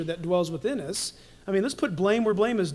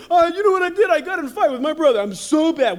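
hammed-up guilt; 5 semitones higher, 6 dB louder, faster than usual. Mock confession to friend.

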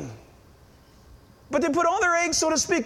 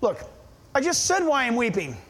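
ordinary talk

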